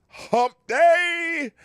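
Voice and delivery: hype announcer call. Acted. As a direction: belt it out like a radio show intro. Show intro hype call.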